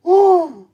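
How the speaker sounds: a high, loud squealing ooh. Real, not acted. Catching breath after laughing, a high loud squeal.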